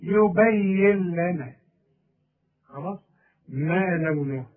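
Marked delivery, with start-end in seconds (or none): 0.0-1.5 slow, the nun dropped in "يبين لنا"; إدغام النون في اللام ببطء; full idgham demo. Shown as a demonstration. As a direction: say "يبين لنا" slowly with the nun merged into the lam. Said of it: "اسقطه من النطق وقول".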